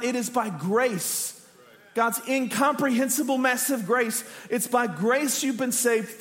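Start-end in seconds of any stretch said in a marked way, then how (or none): none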